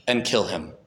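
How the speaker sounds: ordinary delivery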